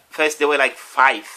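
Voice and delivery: ordinary delivery, nothing marked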